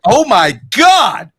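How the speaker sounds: loud, high, drawn-out gasp of horror; mock shock. Host imagines her circle shouting in shocked horror. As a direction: clutch your pearls out loud. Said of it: "They're gonna be like".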